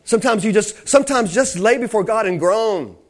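high, impassioned preacher's delivery; fervent preaching. Real, not acted. Preacher teaching how the Spirit prays through believers, preaching fervently. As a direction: press each phrase home with rising fervor.

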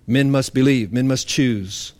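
normal talking voice

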